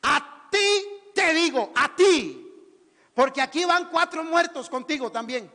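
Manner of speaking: loud, emphatic address; forceful command. Acted. Jesus addressing the dead young man, loud forceful command. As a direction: command it, drawing out the first words.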